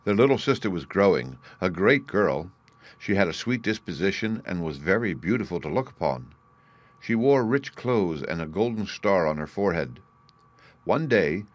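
ordinary delivery, nothing marked

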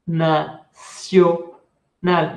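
"Nacional" very slowly with a spoken n. Shown as a demonstration. Teacher repeating the word for students, slowly. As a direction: say "Nacional" syllable by syllable. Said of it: "Uno no dice nacional".

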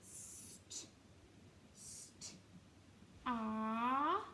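unvoiced "St, st", then voiced "art"; segmenting start. Shown as a demonstration. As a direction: split it into "st" and "art". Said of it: "What sound can you hear at the beginning?".

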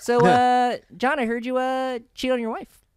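high, slow Aziz Ansari voice; Aziz Ansari impression. Aziz voice teasing John about cheating, high. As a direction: needle him high like Aziz. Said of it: "as Aziz Ansari".

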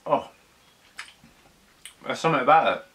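chewing while talking; muffled by food; mouth partly full. Real, not acted. Seen 0: looked down eating; lips pursed, jaw working between words.

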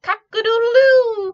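very loud, high, drawn-out crowing; rooster crow. A narrator's reading. Rooster waking up the farmyard, loud high crow. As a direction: crow at full volume like a rooster. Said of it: "crowed the rooster".

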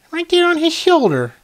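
very high, silly squeal of a line; playful high voice. Pretend. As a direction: pitch it way up, cartoon-like. Playful showing where bird sits.

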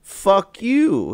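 high, loud, drawn-out mock indignation; exaggerated retold retort. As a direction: act out an over-expressive angry text reply. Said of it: "so much expression in fucking text".

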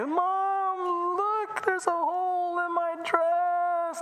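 put-on high, slow, tearful little girl; crying child. Emily shows Mom her torn dress, high and tearful. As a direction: whine it like a heartbroken nine-year-old. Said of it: "she came back crying".

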